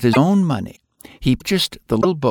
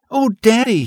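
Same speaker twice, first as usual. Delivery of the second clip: put-on high, eager little boy voice; a young boy calling to his father, excited; little boy voice, pitched high; child crying out; 11 semitones higher, 4 dB louder than usual. A narrator's reading. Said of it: "he cried".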